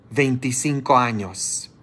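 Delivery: normal talking voice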